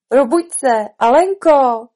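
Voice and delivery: loud, rousing call; calling awake. Acted. Sister wakes Alice from her dream, calling loudly. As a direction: call out to wake the sleeper.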